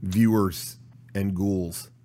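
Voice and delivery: dark lord. Acted. Spooky show opening.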